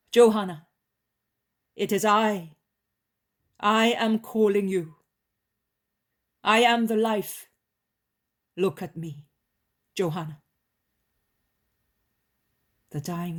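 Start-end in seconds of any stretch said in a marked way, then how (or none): 0.1-0.7 second call, louder and higher, falling. A narrator's reading. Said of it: "He then calls her". 1.8-10.4 commanding call. A narrator's reading. Jesus calls the dying woman back.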